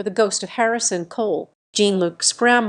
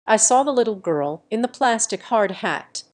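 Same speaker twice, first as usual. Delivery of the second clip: usual speaking voice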